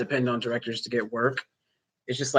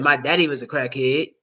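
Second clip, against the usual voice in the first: ordinary talk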